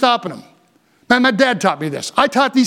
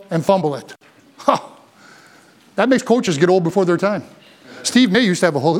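ordinary talk

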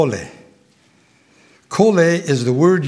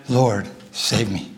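quiet desperate plea; 5 semitones lower, 5 dB quieter, slower than usual. Retold or imitated. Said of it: "So he cries out".

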